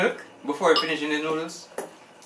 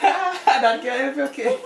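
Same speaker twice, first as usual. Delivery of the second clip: laughing; 13 semitones higher, 5 dB louder, much slower than usual. Real, not acted. Laughing during challenge.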